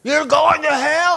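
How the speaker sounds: loud, high, drawn-out street-preacher yell; imitated hellfire scream; mock screaming. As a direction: holler it like a plaza preacher, each word stretched. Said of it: "they'd be screaming".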